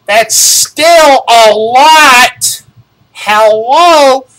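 high, loud, slow disbelief; exasperated outburst. Real, not acted. Complaining to viewers about the rate hike, loud exasperated outburst. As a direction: burst out in disbelief at the cost. Outraged at five dollar hike.

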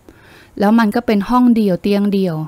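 ordinary delivery

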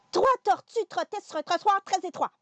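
"Trois tortues trottaient sur un trottoir très étroit" rattled off fast. Shown as a demonstration. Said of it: "Un peu plus vite. Encore".

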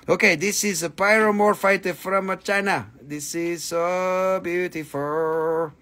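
usual speaking voice

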